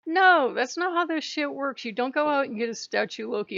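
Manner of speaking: high, angry outburst fading; angry retort. Retold or imitated. Telling off a fake Loki worshipper, angry. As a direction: snap it at them, fired up. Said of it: "I'm like".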